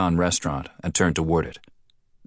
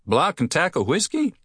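much higher, friendly call across street; a cheerful friend calling over; higher townsman voice; 12 semitones higher than usual. Acted. Sandy-haired man amused question.

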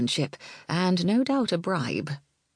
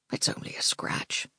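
whispered; 5 dB quieter than usual. Acted. Malgwyn says it dismissively.

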